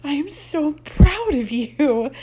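slow, shaky, tearful voice; a mother holding back tears. Acted. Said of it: "she says in a shaky voice".

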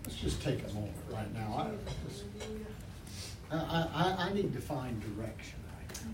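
soft, low, fast prayer murmur; hushed prayer. Acted. Pastor opening service prayer to God, hushed and soft.

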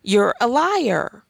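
slow, firm, pointed accusation; blunt accusation. Real, not acted. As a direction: call them out plainly and slowly. Calling out colorblind denial.

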